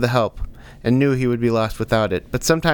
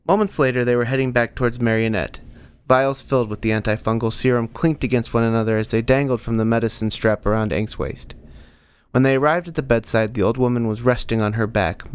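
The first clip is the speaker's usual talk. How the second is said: usual speaking voice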